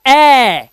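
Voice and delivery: ए held loud as a long "aeee"; stretched, open ऐ for ए; open AE sound. Shown as a demonstration. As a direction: belt out ए like the a in cat. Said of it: "उच्चारण ए का जो होगा वो होगा double ए की मात्रा".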